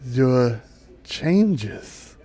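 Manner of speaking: slow dryad voice with long pauses; solemn dryad. Acted. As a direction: reveal it gravely, word by word. Dryad gravely tells the child.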